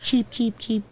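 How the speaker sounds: high squeaky canary chirps; cartoon bird voice. Canary chirping, cat joke.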